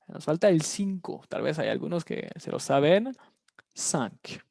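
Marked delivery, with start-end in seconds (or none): none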